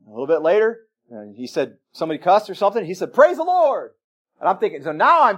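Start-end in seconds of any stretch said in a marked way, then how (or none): 3.1-3.9 higher, louder shout of praise; yelled praise. Retold or imitated. Cook answers a cuss word, shouting praise. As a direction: call it out across the busy kitchen. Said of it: "but he'd yell".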